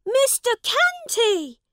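high, loud startled cry of the name; shouted. Acted. Prince shouts at the big man, high and loud. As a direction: call out to him in alarm. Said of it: "shouted the prince".

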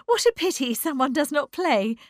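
high, loud excited exclamation; cried out. A narrator's reading. Bertha jumping to the piano, crying out excitedly. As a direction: burst out brightly. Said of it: "she cried".

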